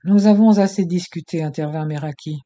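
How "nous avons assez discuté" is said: cutting in. Acted. Meraki cuts in.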